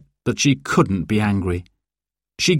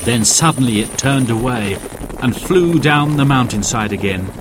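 plain reading voice

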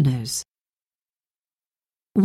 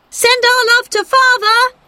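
put-on very high, loud children's call to the passing train; 4 dB louder than usual. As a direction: shout to the train as it goes by. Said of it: "they cried".

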